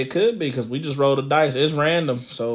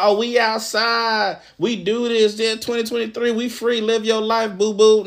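high mocking carefree voice; mock the carefree party girl; mocking women; 7 semitones higher than usual. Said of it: "Y'all the ones talking about".

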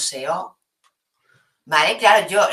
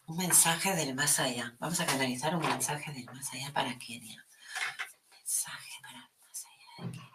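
hushed breathy medium's whisper; seance medium whisper; 2 semitones lower, 8 dB quieter than usual. Acted. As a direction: fade into a secret ritual whisper. Starting to channel a spirit message.

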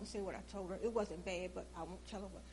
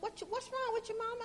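daughter's voice; 13 semitones higher than usual. Retold or imitated.

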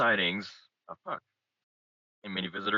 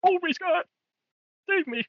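mock terror; 5 dB louder than usual. Mock terror over ghost story.

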